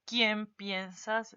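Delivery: slow, deliberate question, each word laid out; slow example question. Shown as a demonstration. Teacher reads a sample question slowly to students. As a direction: read the example out slowly and clearly. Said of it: "Así, tilde".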